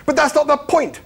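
high, loud, frustrated protest. A narrator's reading. Arthur arguing with Zaphod about eating, protesting. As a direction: burst out in high-pitched objection. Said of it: "protested Arthur".

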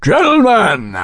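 high, drawn-out announcer's cry; calling out. A narrator's reading. Mister Sayers summoning the company from the stairs, crying out. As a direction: call the company to attention, high and sustained. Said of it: "Mister Sayers cried from the stairs".

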